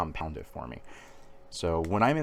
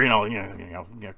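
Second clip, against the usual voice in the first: usual speaking voice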